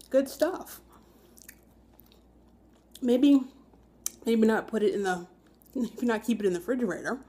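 chewing while talking; speaking between bites; mouth full of toast. Real, not acted. Seen 1: Holds bitten toast at mouth, bites and chews while talking.

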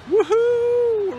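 high, loud, stretched woohoo; a joyful high whoop; woohoo cheer. Real, not acted. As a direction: whoop with delight. Cheering a find.

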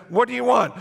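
high, loud, alarmed question; panicked. Retold or imitated. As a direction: blurt it like an overwhelmed teenager. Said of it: "And I said".

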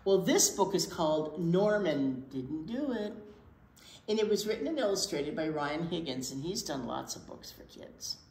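usual speaking voice

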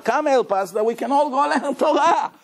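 high, laughing plea to join in; laughing while pleading. Retold or imitated. The poor man laughs asking the rich man to join. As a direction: chuckle through the request. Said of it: "I said".

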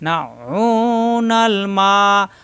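নাউনাল মাউন with the আইন pressed from the throat, slow and drawn; pressed ain. Shown as a demonstration. Teacher showing the pressed আইন sound in মাউন. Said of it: "এখানে ওয়ায়ামনাউ চাপ".

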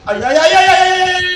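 high, loud string of ay; frantic ay ay ay; panicked wailing. Real, not acted. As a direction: yelp in panic as it grabs you. Being caught by killer.

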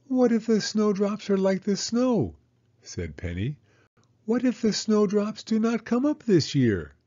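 put-on high child's voice, anxious questions; Penny worrying to Mama, high and anxious; worried little girl. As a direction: fret like a small girl who fears the worst.

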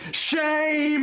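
loud, high call of "Shame!"; shouting. Acted. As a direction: bellow the word out. Shouting at the couple.

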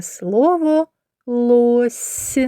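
very slow, clear "Слово місяць"; slow teacher's dictation; slow word naming. Shown as a demonstration. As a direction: sound out the word for children. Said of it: "teacher sounding out the word".

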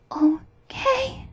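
high, drawn-out longing sigh. A narrator's reading. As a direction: sigh the name in a high, plaintive voice. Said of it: "sighed Gerda".

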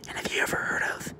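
whisper. Real, not acted. Whispers then repeats aloud.

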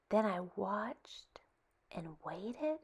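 slow hushed whisper. Acted. Child lying in wait for the alligator, slow whisper. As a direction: breathe it so the alligator won't hear.

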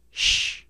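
sh hissed alone, unvoiced; just the sh, no vowel; isolated sh sound. Shown as a demonstration. Teacher isolating the sh sound. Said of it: "Repeat after me".

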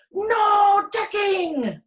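high, loud, very slow crew shout; pirate crew yelling. A narrator's reading. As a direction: roar it back in chorus. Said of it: "the crew yelled".

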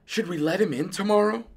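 high-pitched, uncertain wife; wondering. A narrator's reading. Dentist's wife lying awake worrying, wondering. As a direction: ask it high, wondering aloud. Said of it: "Missus DeSoto wondered".

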